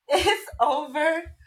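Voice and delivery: the relief of having done it, rising up high; relieved joyful cry. Retold or imitated. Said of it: "that's when I cried".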